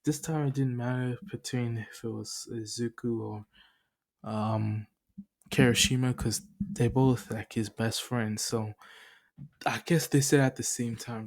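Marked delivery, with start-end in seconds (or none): none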